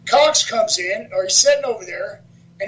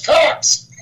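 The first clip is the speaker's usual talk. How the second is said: retold shout of a name, higher; called out; 5 semitones higher, 4 dB louder than usual. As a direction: call the wrestler out. Acting out the boss calling names out.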